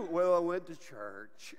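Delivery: low, dragged-out glum reply; mock dejection. Churchgoer glumly answering a friend who asks what happened. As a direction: play it deflated, slowly and low.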